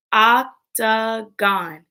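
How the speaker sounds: octagon slowly, in three separate beats; octagon split into syllables. Shown as a demonstration. Teacher breaking words into parts with pupils, octagon split in three. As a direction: space out each syllable of octagon. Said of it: "Break words into parts".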